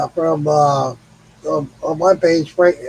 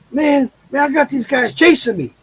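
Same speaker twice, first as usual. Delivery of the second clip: higher, panicked pleading, half shouted; frantic crackhead; 8 semitones higher than usual. Retold or imitated. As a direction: beg like a jittery stranger in trouble. Crackhead claims he's being chased.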